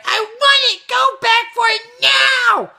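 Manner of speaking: very high, loud imitated child shriek; screaming kid tantrum. Kid demands mom turn back, screaming tantrum. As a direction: shout it as a bratty kid, dragging out NOW. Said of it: "he was much louder than I was".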